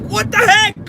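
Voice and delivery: high, frustrated mock yell; exasperated outburst. Yard worker baffled by failing edger, exasperated. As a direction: shoot the pitch up in disbelief. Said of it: "And I was like".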